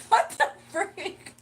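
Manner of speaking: high, loud, drawn-out disbelief; laughing shock. Real, not acted. Streamer reacts to a psychic's prediction, laughing shock. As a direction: shriek it slowly through startled laughter. Startled by the reading about October.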